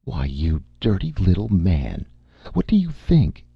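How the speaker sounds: high, scornful retort ending in a sharp high jump; contemptuous snap. Acted. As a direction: spit out her contempt at the little man. Amy answering angrily.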